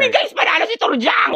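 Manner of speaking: high-pitched victory cry; excited cheer. Acted. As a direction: shout the good news to friends. Friend announces the quiz win.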